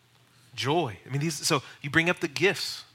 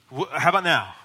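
pushy pressing; 4 dB louder than usual. Shown as a demonstration. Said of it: "Don't press for a response".

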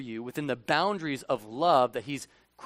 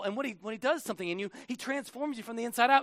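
ordinary talk, nothing marked

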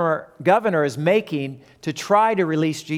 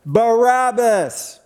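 a higher, drawn-out call from afar; crowd calling out; 6 semitones higher, 3 dB louder, much slower than usual. Acted. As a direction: call the name like a distant crowd. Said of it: "something that Barabbas could hear".

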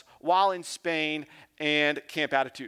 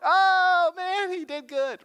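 much higher, louder impressed gasp of a bystander; impressed crowd; 12 semitones higher, 4 dB louder, slower than usual. Retold or imitated. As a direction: sound blown away by the answer. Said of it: "the crowd kinda goes like".

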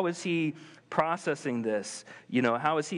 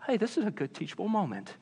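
calm parent voice; 6 semitones higher, 3 dB quieter, faster than usual. Retold or imitated. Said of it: "so present that I'm like".

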